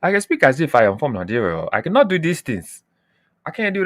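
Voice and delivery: a light Nigerian accent. Shown as a demonstration. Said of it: "I talk like this".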